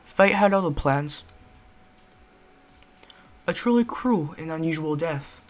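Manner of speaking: grave British storyteller; British accent. Acted. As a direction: somber British narration. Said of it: "British accent for this one".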